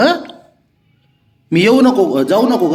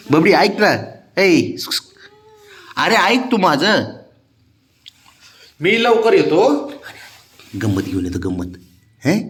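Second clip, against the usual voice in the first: higher, sing-song coaxing of a sulking pet; 4 semitones higher, 7 dB louder than usual. Real, not acted. Owner coaxing his sulking cat Babya before work, sing-song coaxing. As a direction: sweet-talk the cat so it lets you leave.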